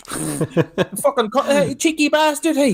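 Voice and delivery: high, slow, strained put-on voice; straining mock grumble. Jerry struggling to hold the patient's legs, high strained slow grumble. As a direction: grumble while heaving a heavy weight.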